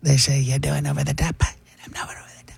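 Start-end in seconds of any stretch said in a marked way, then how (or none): 1.4-2.5 hushed, then a sudden high top; whispered Trump. Shown as a demonstration. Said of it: "You gotta do it quietly".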